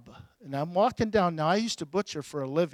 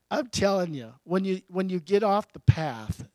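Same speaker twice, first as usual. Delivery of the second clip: ordinary talk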